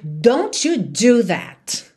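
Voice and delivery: very slow, stern scolding; angry mother forbidding her child, very slow. Shown as a demonstration. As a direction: lean on every word, warning the child. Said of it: "uma mãe brava dizer".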